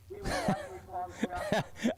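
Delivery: a short burst of real laughter; laughing. Real, not acted. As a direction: break into a laugh at your own joke. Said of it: "can't even say it with a straight face".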